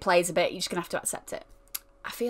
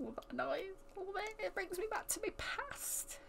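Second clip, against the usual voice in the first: mock emotional; 10 semitones higher, 17 dB quieter than usual. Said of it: "I'm getting emotional".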